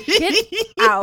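high, laughing mock outrage; laughing get out. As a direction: push them away while laughing. Second take laughing.

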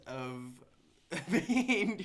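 chuckling through it; laughing. Real, not acted. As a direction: laugh breaks into the word. Laughs mid-sentence.

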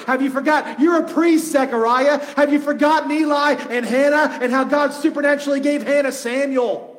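loud, high, exasperated preacher's rebuke; yelling at him. Acted. Preacher scolding a doubting priest about forgotten history, yelling. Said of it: "I want so bad when I read this to yell at him".

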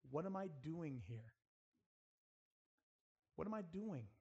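ordinary talk, nothing marked

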